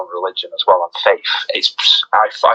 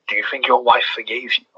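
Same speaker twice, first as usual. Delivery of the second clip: mild taunting; 3 dB quieter than usual. Retold or imitated. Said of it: "he taunts the guard".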